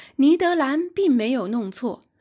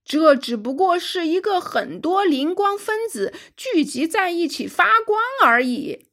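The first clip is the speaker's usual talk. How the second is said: officer's raised, higher-pitched voice; loud declaration; 6 semitones higher than usual. A narrator's reading. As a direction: call it out loudly as a confident officer. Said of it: "一位军官大声说道". (大声说道，音调抬高)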